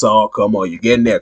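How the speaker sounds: ordinary talk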